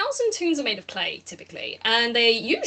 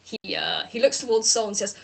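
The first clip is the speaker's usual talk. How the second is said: ordinary talk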